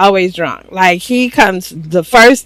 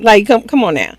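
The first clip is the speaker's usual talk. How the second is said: ordinary talk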